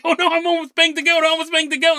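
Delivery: high-pitched mock freak-out; panicked wailing. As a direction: imitate a man babbling in horror. Retold man freaking out.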